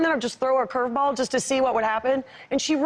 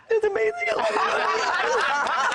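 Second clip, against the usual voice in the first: high-pitched, laughing imitation of a doting mom; mock gushing; 9 semitones higher, much slower than usual. Mom retelling how she praised her acting daughter, gushing. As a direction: gush it, stretching the last words.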